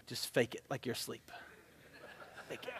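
hushed, breathy under-the-breath urging; sneaking back into bed, hushed self-urging not to wake his wife; whispered self-coaching. Retold or imitated. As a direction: whisper to yourself so she won't hear. Pretending to sleep beside wife.

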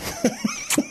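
quick amused giggle; short high laugh. Real, not acted. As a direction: laugh lightly. Laughs at own guess.

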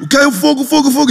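very high, frantic mimicry; going crazy. Crazed neighbour hunting for the fire, frantic. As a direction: mimic a man losing his head over a fire. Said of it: "ele fica louco, ele fica assim".

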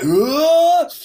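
high, loud "ugh" of shock; alarmed gasp-cry. Retold or imitated. Passenger reliving the plane's dive, high startled cry.

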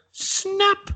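squeaked high snap; mock triumphant snap call. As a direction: yelp the word up high. Amanda Holden calls snap.

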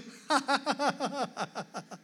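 high, long staccato cackle; mock children's laughter. Children laughing at a phone video, high mock laughter. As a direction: imitate a helpless giggling fit.

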